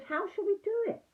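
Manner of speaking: small high anxious voice, well up in pitch; high eager little Piglet voice; squeaky little Piglet. Acted. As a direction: play a timid little pig asking eagerly.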